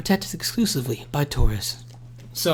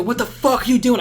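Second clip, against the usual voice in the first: angry yell; 9 semitones higher than usual. Retold or imitated. Retold yelling at fighting friends.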